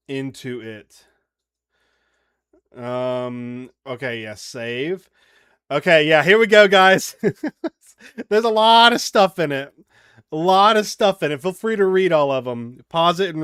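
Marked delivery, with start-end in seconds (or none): none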